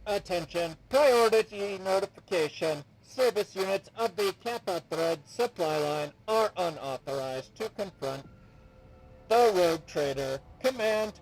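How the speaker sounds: loud, urgent servitor announcement; machine alarm voice. Acted. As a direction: blare it like a warning system. Servitor unit issues alarm.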